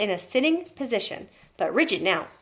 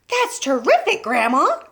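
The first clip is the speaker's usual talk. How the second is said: high, loud delight; delighted cry; 5 semitones higher, 5 dB louder than usual. A narrator's reading. Boy seeing grandma on her feet, delighted cry. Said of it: "George cried".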